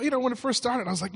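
usual speaking voice

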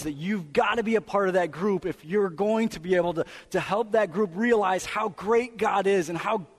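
impassioned pulpit delivery; full-force preaching. Acted. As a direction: drive the point home to the congregation.

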